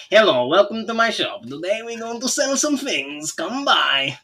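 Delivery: high, far-off Borat-style shop greeting; Borat accent. Shown as a demonstration. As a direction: greet like a cheerful Borat merchant. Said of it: "They'll all be Borat".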